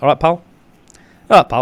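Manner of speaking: cheery imitation of a local; northern greeting. Correcting her northern impression for a friend, mock northern. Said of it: "I'm like".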